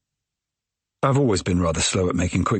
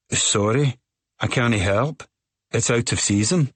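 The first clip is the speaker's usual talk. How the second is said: put-on Scottish accent; 4 semitones higher than usual. A narrator's reading. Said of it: "in a thick Scottish accent".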